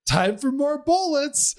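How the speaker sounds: high, slow mock delivery of a cheesy game line; mock game heroine line. Quoting the heroine's reload line to a co-host, mock high voice. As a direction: pitch it way up and ham the cheese. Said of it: "the other one is".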